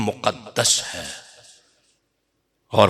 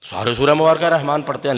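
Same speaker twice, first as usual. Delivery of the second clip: usual speaking voice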